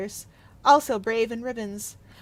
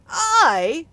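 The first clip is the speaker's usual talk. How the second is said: sharp high indignant cry, much louder; cried out; 5 semitones higher, 9 dB louder than usual. Acted. The Spirit cries out at Scrooge's accusation, loud. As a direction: fling the word back in shock. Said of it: "cried the Spirit".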